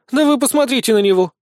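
high, loud jeer; scoffing. A narrator's reading. Small blonde sneers at the sergeant, scoffing. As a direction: scoff at him for all to hear. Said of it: "усмехнулась Безос".

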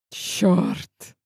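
a breathless whispered curse. A narrator's reading. Hero swears under his breath, dazed by her breath, whispered. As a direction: breathe the word out with no voice.

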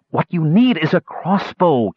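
high, tense retort; a mild hiss through the teeth, still voiced. Pinned woman advising the knight, mildly hissed and tense. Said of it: "Kitiara hissed".

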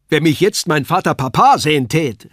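high, loud puppet-clown voice; Kasperl calling out. A narrator's reading. Kasperl boasting as he paces the room, high and loud. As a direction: crow it like a boisterous puppet. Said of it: "rief er".

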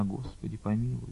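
a faint fainting prayer; barely audible prayer. A narrator's reading. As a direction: pray almost without voice. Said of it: "чуть слышно шептала она".

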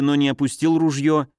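usual speaking voice

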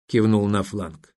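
plain reading voice

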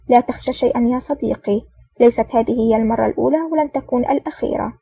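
ordinary delivery

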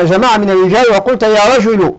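ordinary talk

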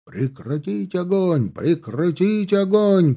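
high, drawn-out repeated call; relayed shout. A narrator's reading. Order flying along the ranks, high shout. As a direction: pass the order along, stretched out. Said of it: "полетело по шеренгам".

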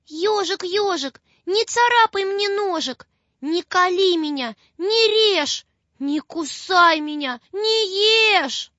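put-on high, crying little girl's plea; a scared child's teary rhyme; frightened plea. A narrator's reading. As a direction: beg the hedgehog through a sob. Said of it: "думает девочка Маша".

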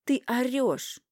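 high, loud accusation. Acted. Wife contradicting her shouting husband, heated. Wife hits back in quarrel.

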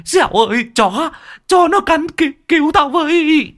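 yelling for help as the dog attacks; panicked screaming. Acted. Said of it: "Miệng của lão gào lên choé choé". (gào thét hoảng loạn, giọng rất cao)

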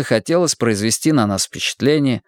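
plain reading voice

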